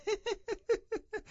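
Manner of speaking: very high, soft snickering; mocking giggle. Youth laughing at his own mimicry of cousin, mocking giggle. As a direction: titter in derision.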